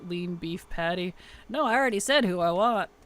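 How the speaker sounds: usual speaking voice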